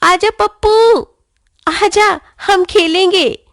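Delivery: high, loud call across the field; calling from afar. A narrator's reading. Sonu calls Pappu from across the field, loud and high. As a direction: shout to a friend far away. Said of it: "Sonu दूर से चिल्लाकर".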